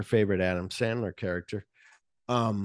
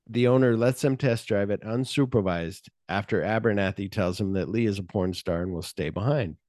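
normal talking voice

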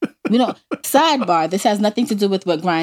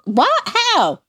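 mock dismay; 9 semitones higher than usual. Mock dismay at own accent.

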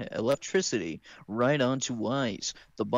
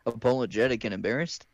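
ordinary talk, nothing marked

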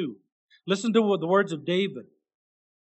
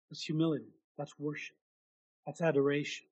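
hushed; 3 semitones lower, 9 dB quieter than usual. Real, not acted. Soft summing up after verse.

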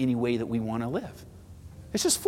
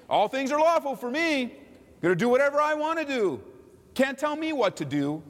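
high, defiant mock boast; 10 semitones higher than usual. Preacher voices the lawless person, high defiant mock. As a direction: sneer it like a rebel.

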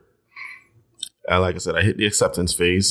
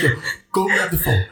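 high, hurried reenactment; replayed urgency, speeding up; retold frantic order; 8 semitones higher, faster than usual. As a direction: rattle it off high, in mock panic. Acts out frantic order to partner.

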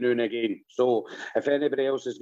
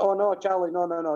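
higher-pitched mock parental no-no; imitated dad's gentle telling-off; flustered dad correcting; 6 semitones higher than usual. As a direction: hurriedly correct a wee boy, pitched up. Acted dad hastily correcting son.

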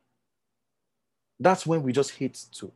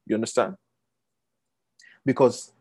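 ordinary talk

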